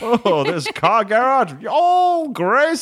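high, slow showing-off voice; a high, gleeful ghost showing Grace her new garage; excited ghost tour. Pretend. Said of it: "He's like".

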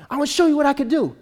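high, fast pestering; imitated nagging. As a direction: play a player badgering his coach. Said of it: "Zach's nagging him".

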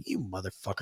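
muttered curse. Retold or imitated. Son's reaction to dad.

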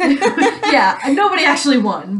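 laughing loudly through the words. Real, not acted. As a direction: laugh it out, loud and high. Laughing at the lost series.

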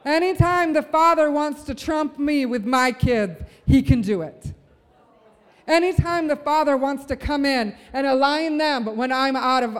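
forceful sermon declaration, leaning on key words; impassioned preaching. Real, not acted. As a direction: preach it out with conviction to the whole room. Preaches and calls people to stand.

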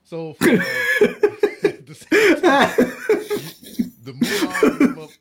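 high, loud laughing fit; can barely talk for laughing; laughing hard. Real, not acted. Laughing fit mid-sentence.

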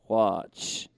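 low, unmoved magician impression; David Blaine. Street magician ignores the spectator's amazement, low deadpan. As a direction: drop to a deep, deadpan command. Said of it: "give Ollie the voice of".

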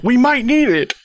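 high, pleading; lightly voiced worried friend; mock whiny plea. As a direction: beg them not to kill it. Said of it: "Eric's like".

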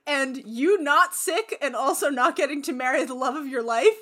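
giggling apology from the shark; mock apology broken by laughs; laughing refusal. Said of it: "Samebito's like".

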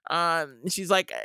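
ordinary talk, nothing marked

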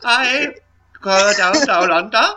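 high, hammed-up Australian accent; a silly Australian going down under. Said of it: "try to do an Australian".

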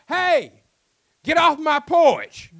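loud, high, slow angry yell. Acted. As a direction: bellow him off the porch. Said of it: "and he yells".

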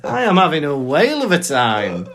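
high, hammed-up bravado; gleeful relish. Mock dying Harry loving it, hammed-up delight. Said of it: "he's loving it".

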